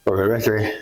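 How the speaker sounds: ordinary delivery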